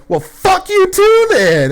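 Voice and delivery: very high, loud, slow screech; mock furious old lady. Drunk lady snapping at the bartender, high loud mock screech. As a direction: shriek it like a drunk lady who got kicked out. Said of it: "She goes".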